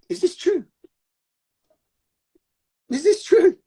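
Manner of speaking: high, acted-out questioning of a belief; incredulous questioning. Tuning in to test an old belief, high questioning. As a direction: put the question in a high, wondering voice.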